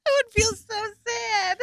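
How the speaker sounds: real tears creeping into the words; "I would feel so sad" choked out while really crying. Real, not acted. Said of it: "I'm gonna start crying again".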